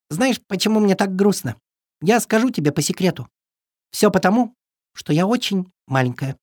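high secretive little bird; tiny bird sharing a secret; cartoon bird voice. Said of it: "высунулась наружу и шепнула". (голосок птички по секрету)

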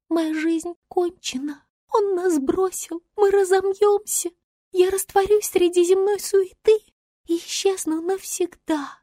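high, thin dying-star voice; faint fading star. A narrator's reading. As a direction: lament weakly in a high voice. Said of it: "еле слышно шептала". (слабый угасающий голос звезды)

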